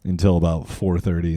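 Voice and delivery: normal talking voice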